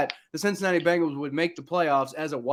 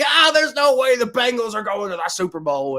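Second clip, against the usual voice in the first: raised, scoffing imitation of a doubter; mock heckling; 6 semitones higher, 4 dB louder than usual. Imitating the Steelers fan laughing at the prediction, mock heckling. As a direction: jeer it as a dismissive rival fan. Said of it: "laughing and heckling him and being like".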